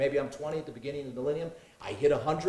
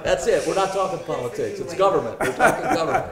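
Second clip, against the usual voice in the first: higher and louder, laughing along; 5 semitones higher, 11 dB louder than usual. Real, not acted. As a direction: playfully give in, still laughing. Joking correction, laughing.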